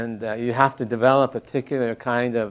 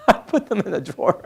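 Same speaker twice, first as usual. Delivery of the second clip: laughing through the words; 8 semitones higher, 4 dB louder than usual. Real, not acted. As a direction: tell it through a burst of laughter. Laughs while telling own story.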